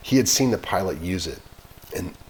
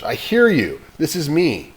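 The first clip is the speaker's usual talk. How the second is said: higher, louder eager reply; 8 semitones higher, 7 dB louder than usual. Acted. Boy answering the radio, eager and louder. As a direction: jump at the chance to answer.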